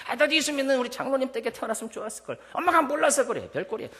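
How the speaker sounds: high, loud mocking voice of a smug child; mock a know-it-all child looking down on mom; condescending child. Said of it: "이러면 안 된다는 거예요".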